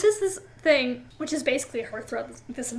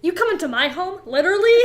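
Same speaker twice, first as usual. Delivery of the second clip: loud, higher indignant disbelief; mock outrage; 5 semitones higher, 6 dB louder than usual. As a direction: throw the question back, half laughing. Outraged mock quote about Jacob.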